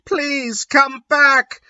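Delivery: high, slow whiny plea; hammed-up sad plea; melodramatic pleading. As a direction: beg in an overdone high voice. Draco pleads in reading.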